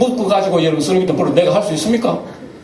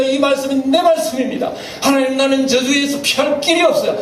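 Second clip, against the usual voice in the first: a humble confessing prayer, pitched up; pleading prayer; 8 semitones higher than usual. Pretend. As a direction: plead before God, lamenting. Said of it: "기도할 수밖에".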